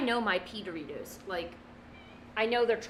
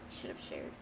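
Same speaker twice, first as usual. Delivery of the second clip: under-the-breath whisper aside; 14 dB quieter than usual. Real, not acted. Grumbling to herself about the eight ball, whispered. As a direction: whisper it to yourself, half joking.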